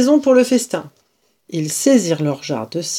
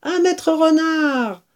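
high, slow cheer; cheering toast; 7 semitones higher, 4 dB louder than usual. Acted. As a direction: cheer the hero.

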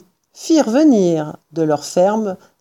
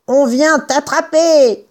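higher, drawn-out taunting call; menace criée; 6 semitones higher than usual. A narrator's reading. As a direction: call out a threat, slowly and high. Fermier menaçant le renard. (menace lancée en criant)

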